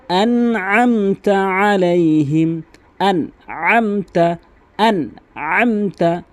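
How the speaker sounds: chanted আনআমতা with squeezed throat ayn after আন; clear ayn. Shown as a demonstration. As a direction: recite আনআমতা slowly, pressing the ayn. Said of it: "আইনের উচ্চারণ করতে হবে". (আনআমতা-য় স্পষ্ট আইন)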